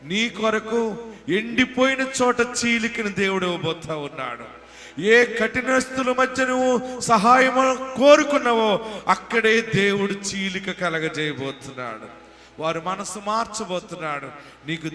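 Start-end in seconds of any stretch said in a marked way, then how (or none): 0.1-12.1 loud, raised sermon voice with surging peaks; forceful preaching. Acted. Preacher promises the congregation God will open a spring, forceful preaching. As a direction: proclaim it to the congregation in a high, driving voice.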